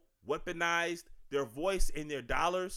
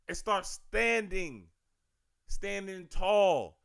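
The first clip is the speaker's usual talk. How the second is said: slow emphatic call; much slower than usual. Real, not acted. Rallying call to stand firm.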